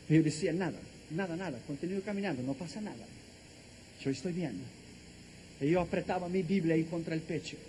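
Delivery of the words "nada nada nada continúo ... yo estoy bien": low, soft, dismissive reply; quiet brush-off. Retold or imitated. Crying traveller reassuring airport passersby, quiet brush-off. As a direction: drop the voice and play it down.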